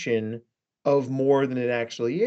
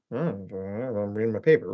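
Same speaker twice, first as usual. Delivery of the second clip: lower, distracted and unbothered; absent-minded mumble; 6 semitones lower, 4 dB quieter than usual. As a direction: hum it while lost in the paper. Retold self ignoring grandma's hint.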